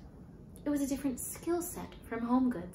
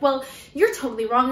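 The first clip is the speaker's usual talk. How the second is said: indignant retort; 4 semitones higher, 10 dB louder than usual. Retold or imitated. Heated retold argument.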